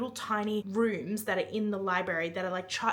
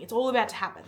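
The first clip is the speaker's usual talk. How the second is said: usual speaking voice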